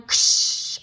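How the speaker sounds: whooshing ksh; sword swish. Acted. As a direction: make the sword strike. Sword sound effect.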